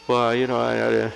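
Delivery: mock faltering child, tripping on "I"; imitated stammering. Grandson asked by his mother to speak up, stammering. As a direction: stall and stumble, imitating a flustered kid. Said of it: "and he's stammering".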